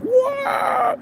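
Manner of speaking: very high, held mock tire screech; imitated panic-stop screech. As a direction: squeal like brakes locking up. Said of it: "He's just panic stopping".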